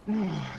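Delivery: voiced groan of frustration; frustrated sigh. Iku kicks out over her friend's death, groaning in frustration. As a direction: let out an exasperated groan. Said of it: "she just seems absolutely frustrated".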